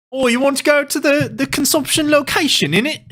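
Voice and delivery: high, hammed-up bad British accent. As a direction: overdo a British accent with innit. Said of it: "My British accent's terrible".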